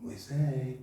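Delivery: gentle granny calling a pet name; tender grandmother call; grandma voice. Retold or imitated. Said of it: "voz de vó".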